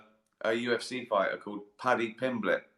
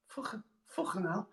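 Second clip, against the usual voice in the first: soft Scouse swearing with throaty k; Scouse accent; 9 semitones higher, 10 dB quieter than usual. Shown as a demonstration. Comedian testing the throaty sound, soft Scouse accent. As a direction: try Scouse quietly, rasping the k. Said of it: "They do that, don't they?".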